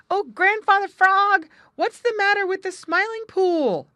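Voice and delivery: high, loud urgent call; shouted. A narrator's reading. Two young animals racing up to Grandfather Frog, shouting. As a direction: holler up to an elder in a high voice. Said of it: "they shouted as they came up".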